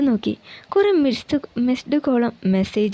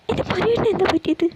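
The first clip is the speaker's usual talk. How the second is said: high, alarmed wife asking; shocked worry; 8 semitones higher, faster than usual. Acted. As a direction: ask in sudden alarm, pitched up. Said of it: "അവൾ ഞെട്ടി". (ഞെട്ടലോടെ ഉയർന്ന ശബ്ദത്തിൽ)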